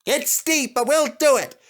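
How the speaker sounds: rhythmic engine effort; determined puffing. Acted. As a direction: push up the hill together. Engines chugging up hill.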